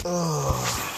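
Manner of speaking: loud, exasperated "ugh"; weary groan. Real, not acted. Tired aside in a rambling vlog.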